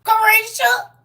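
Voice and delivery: Carisha yelled out high and loud, stretched across the room; hollering Carisha across a family cookout; loud name call. Shown as a demonstration. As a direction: call out Carisha like the embarrassing cousin. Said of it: "Let me stop saying it like that".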